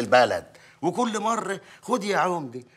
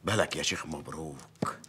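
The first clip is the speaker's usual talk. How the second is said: hushed confiding whisper; mayor whispering his wish to the sheikh; 14 semitones lower, 8 dB quieter than usual. Acted. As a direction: lean in and whisper the secret.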